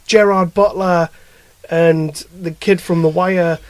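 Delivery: normal talking voice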